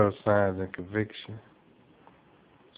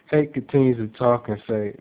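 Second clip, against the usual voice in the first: ordinary delivery, nothing marked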